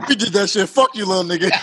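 words broken by laughter; cracking up mid-sentence; laughing. Real, not acted.

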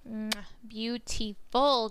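slow smacking kiss sound, then a drawn-out beautiful; chef's kiss. Real, not acted. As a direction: savour it with a kiss to the fingers. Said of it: "the kissy sound, like, mwah".

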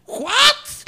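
sudden very high squeak of disbelief; shocked what. Retold or imitated. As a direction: jump way up in comic shock. Elí's shocked reaction retold. (sorpresa cómica muy aguda)

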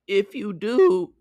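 high, loud, drawn-out wolf voice; cartoon wolf, pushed up high; wolf's threat. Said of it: "I'm changing all these voices up".

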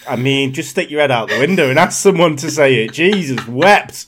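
teasing despair at a friend's accent; loud, fed-up disbelief; exasperated. Real, not acted. Mock exasperation at the bad accent.

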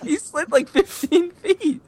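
very high, slow amazed squeal. Real, not acted. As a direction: stretch it out in disbelief. Amazed exaggeration.

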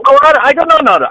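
high, loud, tumbling interruption; mock-flustered loud objection; retold heated protest. Retold heated back and forth.

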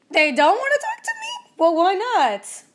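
shocked and offended; indignant surprise. Retold or imitated. Answering her coach, high and indignant. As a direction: pitch jumps way up on they don't wanna talk to me, then a slow why not. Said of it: "And I was like".

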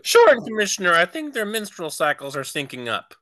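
O'Hara answering the commissioner, hammed-up; mock chief. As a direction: chirp it back to the boss.

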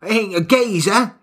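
lightly voiced Cockney husband; Cockney, flustered excuse; a hint of a sheepish Cockney. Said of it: "he was like".

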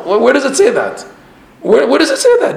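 high-pitched, insistent challenge; high, incredulous rhetorical question. Real, not acted. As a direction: demand proof, pitch leaping up. Challenging an imagined objection.

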